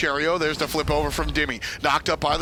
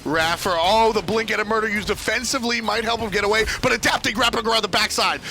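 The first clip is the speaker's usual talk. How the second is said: urgent, higher-pitched chase commentary; excited play-by-play; 5 semitones higher than usual. Real, not acted. Caster following a player trying to get away, excited.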